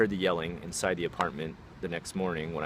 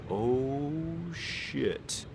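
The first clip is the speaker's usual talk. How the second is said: drawn-out, higher-pitched oh-shit; dawning dread; 6 semitones higher, 4 dB quieter than usual. Retold or imitated. Neighbor overhearing the threat, dawning dread. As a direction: let the alarm sink in slowly, under your breath.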